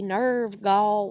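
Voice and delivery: ordinary delivery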